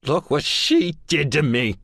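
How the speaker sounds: high, cracking cry of hurt; voice breaking. A narrator's reading. Son to father, hurt and breaking, pitch up. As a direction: let the voice crack on it. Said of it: "the break in my voice".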